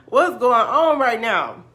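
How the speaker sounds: high, loud, drawn-out disbelief; hammed-up freak-out; loud bewilderment. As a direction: wail it slowly in comic astonishment. Retold shock, escalating after laugh.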